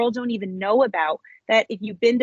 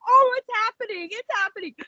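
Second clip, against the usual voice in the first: much higher, giddy repeat; bubbling over with excitement; excited disbelief; 13 semitones higher than usual. Retold or imitated. Woman tells host her answered prayer, giddy. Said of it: "I was like".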